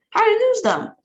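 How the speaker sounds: shouting. A narrator's reading. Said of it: "shouted the president".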